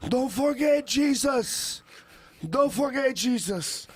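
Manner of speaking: put-on slow, high thick Cuban accent. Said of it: "thick Cuban accent".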